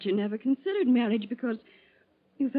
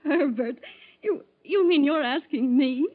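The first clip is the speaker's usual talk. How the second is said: breathless, tearful joy with a little laugh; choked up with happiness at the proposal; a teary laugh of delight; overjoyed; 5 semitones higher, 5 dB louder than usual. Acted. Proposal accepted, overcome.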